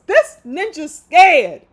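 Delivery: a slow, loud hint of [name]. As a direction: drag it out slowly, [name]-style, loud. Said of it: "I gotta say that in my [name] voice".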